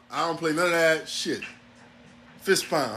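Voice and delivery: ordinary talk, nothing marked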